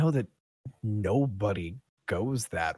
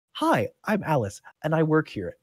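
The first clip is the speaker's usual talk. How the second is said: higher-pitched, self-introducing character voice; Alice; 6 semitones higher than usual. Acted. Kitchen worker introducing herself with a curtsy, higher voice. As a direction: introduce yourself in a lifted, higher voice. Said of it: "and she goes".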